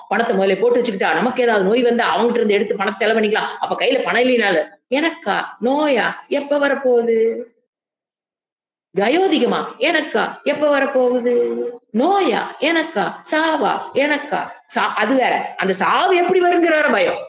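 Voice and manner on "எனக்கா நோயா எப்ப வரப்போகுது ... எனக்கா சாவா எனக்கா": scoffing sage. Retold or imitated. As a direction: laugh off death itself. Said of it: "internal dialogue".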